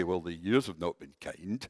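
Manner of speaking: put-on low, catty put-down of an old friend; catty remark. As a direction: sniff it out like village gossip. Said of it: "the catty ones would say".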